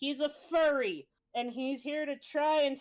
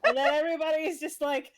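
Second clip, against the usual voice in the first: words spoken through a laugh, a bit faster; laughing while talking; 3 semitones higher, 6 dB louder, faster than usual. Real, not acted. Narrator recapping the session, laughing through it. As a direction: talk on while still laughing.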